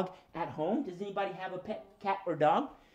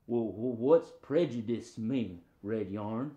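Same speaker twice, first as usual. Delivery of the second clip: puzzled puppet dog; 6 semitones lower, slower than usual. Pretend. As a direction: ask hesitantly as a confused dog. Puppet dog asks the host.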